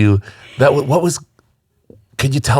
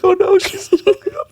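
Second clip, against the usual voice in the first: much higher, a cry of alarm. Retold or imitated. Host retelling watching the live stream, a high alarmed cry. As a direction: panic for her on the live stream. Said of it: "I was like".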